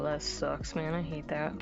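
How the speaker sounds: ordinary delivery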